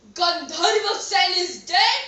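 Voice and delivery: loud disbelief, rising on "dead"; shocked question. Acted. King hears of the death from his minister, shocked.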